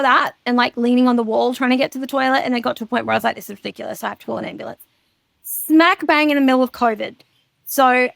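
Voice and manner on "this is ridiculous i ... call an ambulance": muttered to herself. Retold or imitated. Retold thought while in pain.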